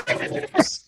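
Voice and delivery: sudden high-pitched outburst; mock high shriek from the purse; imitated scare noise. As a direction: mimic a sudden jump-scare cry. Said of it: "He scared the hell out of me".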